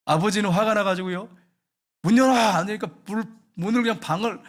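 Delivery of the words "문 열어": high, loud barked command; angry father's shout. Retold or imitated. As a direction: shout at a locked door. Furious father at the door. (화난 아버지의 고함)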